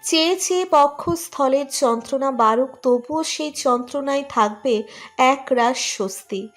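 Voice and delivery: ordinary delivery, nothing marked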